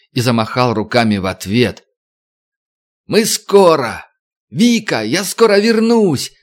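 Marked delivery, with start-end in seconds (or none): 3.1-6.3 high tearful farewell shout. Acted. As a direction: yell it across the water. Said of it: "кричал во всё горло".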